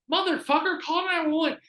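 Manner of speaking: high, loud yell of disbelief; yelling at the screen in frustration; mock exasperated yell. Yelling at Randall on screen, high and loud.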